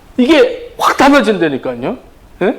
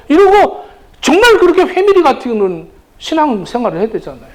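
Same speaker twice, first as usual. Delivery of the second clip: usual speaking voice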